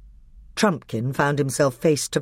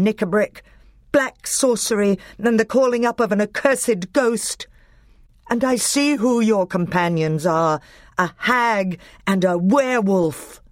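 raised, high, indignant denunciation; thunderous royal outrage; 8 semitones higher than usual. A narrator's reading. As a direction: accuse them in a ringing voice. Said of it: "rose the voice of King Caspian like thunder".

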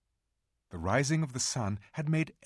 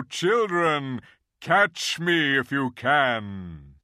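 louder, slow, booming playful lion; joyful lion; 4 semitones higher, 8 dB louder, much slower than usual. Acted. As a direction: roar it with returning strength. Aslan feels strength returning.

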